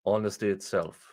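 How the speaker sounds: low, flat sarcastic echo; sarcastic deadpan. Real, not acted. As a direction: mutter it with disbelief.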